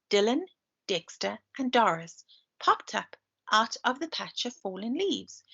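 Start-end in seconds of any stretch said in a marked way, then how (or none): none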